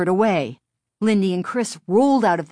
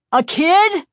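high, loud cry of horror; horrified cry; 13 semitones higher, 6 dB louder than usual. A narrator's reading. Driver fearing he has crushed a child, horrified cry. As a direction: cry out in shocked disbelief. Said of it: "he cried".

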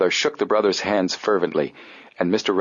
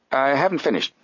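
put-on stiff old gentleman; 7 semitones higher than usual. Applegate voiced.

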